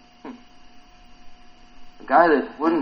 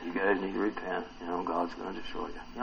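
low, soft, subdued warning; understated warning; 8 semitones lower, 13 dB quieter than usual. Acted. Preacher wonders how Jonah warned the city, quiet and understated. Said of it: "I wonder if he did that".

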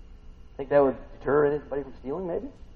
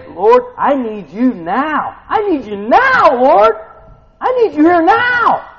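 high, loud, drawn-out plea; desperate prayer shout; 13 semitones higher, 13 dB louder, slower than usual. Acted. Preacher acting Daniel begging the Lord, desperate shout. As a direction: cry out to heaven, stretching the words. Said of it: "Would you say".

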